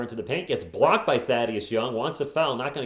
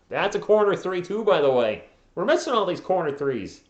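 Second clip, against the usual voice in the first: higher and louder, exasperated about the misses; frustrated outburst; 7 semitones higher, 5 dB louder than usual. Real, not acted. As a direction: let the frustration burst out higher. Frustrated at missed corner threes.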